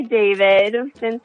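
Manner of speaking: normal talking voice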